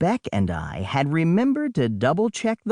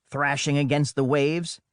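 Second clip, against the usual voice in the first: ordinary delivery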